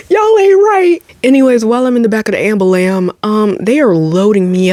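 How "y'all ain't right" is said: much higher, louder, half-laughing wail; laugh-cry lament. Real, not acted.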